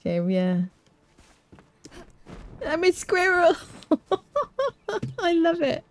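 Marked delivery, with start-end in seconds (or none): 2.7-5.8 very high delighted squeal; giddy laughter. Real, not acted. Player loving her squirrel form, high giddy laughing.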